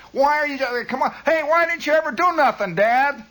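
put-on high, loud whining kid voice; nagging kid to dad. Radio host playing a son nagging his dad, high whiny kid. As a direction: pester your father like a bratty son.

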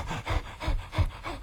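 quiet breathy gasp, high and soft; gasp into mask. Retold or imitated. Soldier pulling on a gas mask, gasping softly. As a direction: suck in air as the mask goes on. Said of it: "and you go".

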